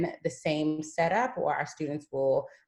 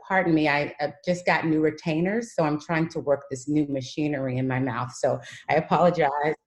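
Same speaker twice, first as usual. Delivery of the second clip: ordinary talk, nothing marked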